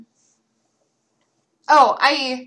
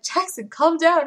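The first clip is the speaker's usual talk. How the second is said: mild scold; 5 semitones higher, slower than usual. Retold or imitated. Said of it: "I was like".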